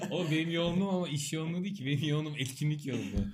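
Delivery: high, soft and laughing. Real, not acted. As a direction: giggle through the words. Laughing reply to joke. (gülerek, yumuşak)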